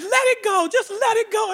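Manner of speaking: high soothing coax; mock tender coaxing. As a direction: gently urge her to release. Pedicurist coaxing.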